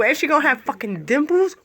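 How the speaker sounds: ordinary talk, nothing marked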